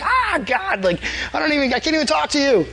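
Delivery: high-pitched mock panic; hammed-up frightened squeal of a voice; scared. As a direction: act overwhelmed and terrified before God. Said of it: "my knees would be shaking".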